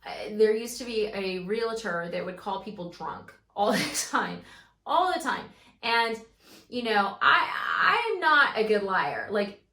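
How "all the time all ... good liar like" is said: much louder, laughing and exclaiming; loud outburst. Real, not acted. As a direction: blurt it out loud, half laughing. Laughing outburst about the drunk realtor.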